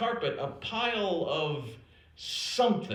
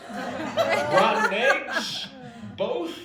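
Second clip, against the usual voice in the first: laughing mock guess; 6 semitones higher, much slower than usual.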